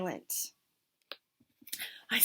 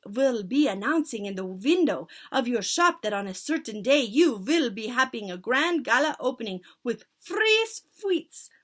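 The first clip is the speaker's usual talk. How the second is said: loud witch leader giving orders; commanding witch; 4 semitones higher, 6 dB louder than usual. Acted. Witch leader instructing her followers, loud. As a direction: command the crowd grandly. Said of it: "cried out".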